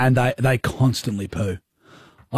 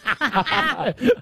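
sudden high burst of laughter; 12 semitones higher than usual. Real, not acted. Host cracking up at co-host's mimic, high laugh. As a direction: crack up at the impression.

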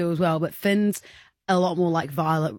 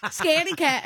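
put-on high sing-song kid taunt; high mocking child chant; 7 semitones higher than usual. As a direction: tease like a cheeky five-year-old. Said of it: "he'd go".

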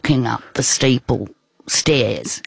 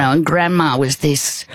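ordinary talk

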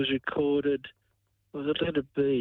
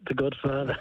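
mock Sicilian mobster voice, lightly done; a hint of a Sicilian accent. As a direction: say the title like a Sicilian don. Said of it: "I'll answer in my best Sicilian accent".

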